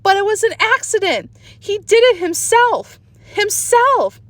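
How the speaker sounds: high pleading protest. A narrator's reading. Sheila pleads with Peter, high tearful protest. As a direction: insist through tears it was an accident. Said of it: "Sheila cried louder".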